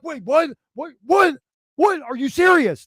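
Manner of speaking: high, stammered string of startled questions; acted disbelief. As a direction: replay being stunned, pitched high. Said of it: "And I'm like".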